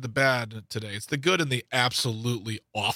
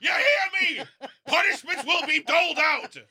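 mock-menacing shout, pitched way up; hammed-up punisher; 11 semitones higher than usual. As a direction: announce punishments like a ranting overlord. Acts out harsh festival punisher.

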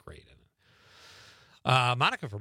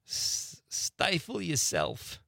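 higher, slow, drawn-out sitcom grouch impression; bad Archie Bunker; 7 semitones higher, much slower than usual. Host quoting a classic TV grouch, hammed-up slow impression. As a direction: badly imitate a sitcom grouch, stretching it out high. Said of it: "That was my bad Archie Bunker, uh, accent".

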